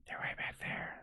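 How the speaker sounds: barely voiced whisper; secretive stage whisper; hushed aside. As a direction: whisper it behind someone's back. Townsfolk whisper about players.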